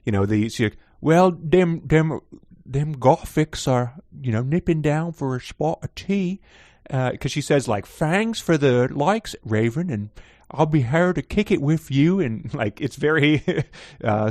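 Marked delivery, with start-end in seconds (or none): 1.0-6.4 lightly put-on Liverpool accent; a hint of Liverpool accent; mock Liverpool accent. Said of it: "Liverpoolian type of accent". 7.9-12.2 put-on a light, weird British accent; a hint of a British accent; mock British accent. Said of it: "sort of a weird British accent".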